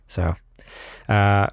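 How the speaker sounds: usual speaking voice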